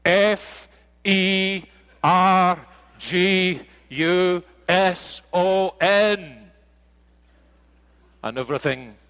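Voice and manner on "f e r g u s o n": high, loud, slow letter-by-letter spelling; irritated little girl. Retold or imitated. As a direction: spell it out like a cross two-year-old. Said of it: "very young, very female, and very irritated voice".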